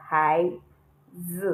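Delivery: "hai z" with z falling sharply; hai, then z in a falling fourth tone; wrong fourth tone. Shown as a demonstration. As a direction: drop the pitch firmly on z after hai. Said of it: "because there is no fourth tone here".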